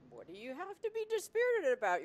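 high, loud disbelief; asking herself in disbelief; incredulous. Retold or imitated.

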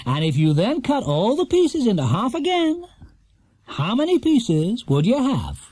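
quiz show host. A narrator's reading. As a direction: spell out the puzzle like a showman. Host reads the question.

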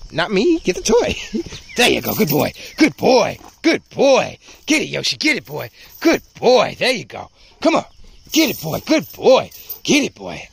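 high, playful praise bursts; real tug-game encouragement; excited dog praise. Real, not acted.

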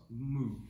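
drawn-out cow moo. Shown as a demonstration. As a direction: moo on the inhale. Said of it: "cow pose".

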